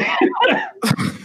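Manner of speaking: very high squeal of laughter. Real, not acted. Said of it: "He's crying".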